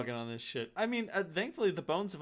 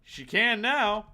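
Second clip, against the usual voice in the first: teasing; 9 semitones higher, 7 dB louder, slower than usual. Acted. GM teasing reply.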